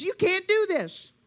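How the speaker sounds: devil's discouragement. Retold or imitated. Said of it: "The devil says".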